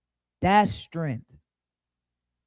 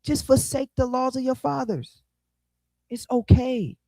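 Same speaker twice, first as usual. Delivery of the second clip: a lightly voiced king, hushed and persuasive; smooth-talking king; 5 semitones higher than usual. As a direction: sweet-talk him in a hushed, hurried voice. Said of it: "He like".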